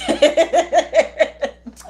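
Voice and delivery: big high laugh bursting out; loud burst of laughter. Real, not acted. Streamer cracking up at her chat, loud laugh.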